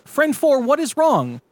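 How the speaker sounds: high, hammed-up worried plea; worried friend voice. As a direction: overplay the concern. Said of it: "Friend two says".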